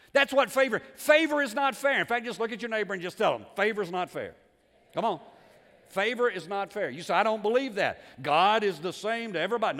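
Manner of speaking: loud, emphatic pulpit delivery; full-force preaching. Real, not acted. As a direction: hammer the point home to the congregation.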